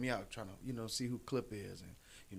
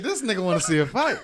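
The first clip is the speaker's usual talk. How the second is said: louder, higher incredulous call; loud disbelief; 7 semitones higher, 14 dB louder than usual. Retold or imitated. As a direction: call it out in amazement. Disbelief at bully waiting.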